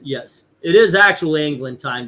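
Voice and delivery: normal talking voice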